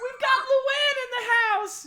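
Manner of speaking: very high, very loud squeal of recognition; squealing very loud at spotting the celebrity; excited shout. Acted. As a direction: shriek it like a starstruck fan. Darnsky announces to tavern.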